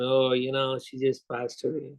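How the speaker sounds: lightly imitated American accent, low and subdued. Customer tells visitors his mother died, light American accent. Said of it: "said in his American accent".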